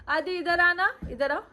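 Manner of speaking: high-pitched coaxing call. Real, not acted. Mother calls her son to the camera, coaxing. Calling toddler over to camera.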